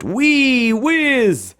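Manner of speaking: high, swooping whee; gleeful whoosh. A narrator's reading. Narrator skiing down the mountain, high and gleeful. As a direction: ride the sound down the slope. Bear slides after her.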